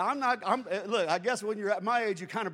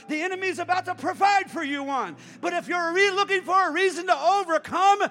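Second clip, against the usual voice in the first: loud, rising shouted preaching; full-voiced revival preaching; preaching at full force; 7 semitones higher, 9 dB louder than usual. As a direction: build the crowd up, louder and louder.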